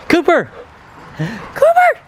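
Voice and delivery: high calling shout; calling a dog. Real, not acted. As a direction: call the dog across the yard.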